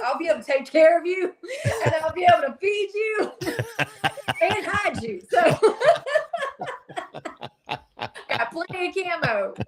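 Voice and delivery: giggling while she talks; laughing. Real, not acted. Offering the host shelter, laughing. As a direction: laugh through the boast. Joking about apocalypse shelter.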